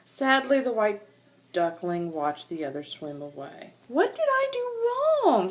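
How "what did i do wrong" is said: much higher, slow, small plaintive voice; sad wondering. Acted. Lonely duckling wonders why he's left, small and plaintive. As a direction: ask it like a lost little child. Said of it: "he wondered".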